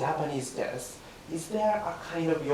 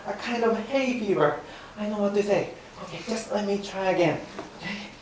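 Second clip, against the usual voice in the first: normal talking voice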